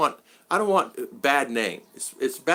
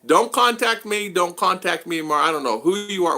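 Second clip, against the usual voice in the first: angrily telling a stranger off on Facebook; angry retort; 7 semitones higher, 4 dB louder than usual. Retold or imitated. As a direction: snap it at the stranger, fed up. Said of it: "I'm getting angry at that time".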